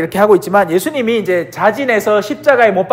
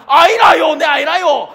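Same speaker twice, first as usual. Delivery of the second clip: higher, stubborn mock denial; Peter denying; 8 semitones higher than usual. Peter keeps denying to the woman, higher mock voice. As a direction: keep shaking it off.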